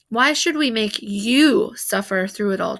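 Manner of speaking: usual speaking voice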